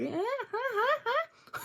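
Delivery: high imitated chimp grunts; lightly imitated monkey sounds; chimp noises. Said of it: "He's like".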